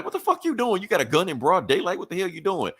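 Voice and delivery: high, shocked outrage; outraged. Acted. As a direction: yell at the cop who drew a gun. Batman stops cop pulling a gun.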